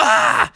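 high, loud hammed-up scream; a shriek of a punched villain; mock scream. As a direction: shapeshifter hit in the throat, hammed-up shriek.

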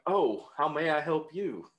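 slow, high, hammed-up charming line; mock smooth flirt.

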